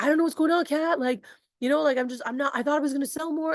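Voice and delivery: imitating a worried rookie; a touch of panic; frustrated new rep. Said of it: "he's like".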